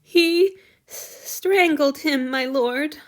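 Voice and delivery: ordinary delivery, nothing marked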